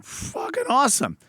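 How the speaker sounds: high excited burst; thrilled. Retold or imitated. Reacting to the raise offer, thrilled. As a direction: blurt it out thrilled. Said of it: "I was like".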